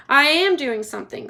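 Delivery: husband defending himself; defensive. Acted. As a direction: snap back. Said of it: "said Mister Gloop".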